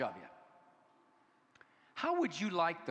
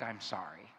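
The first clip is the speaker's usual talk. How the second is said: acted-out apology; 5 semitones lower than usual. Shown as a demonstration. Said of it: "but all I act".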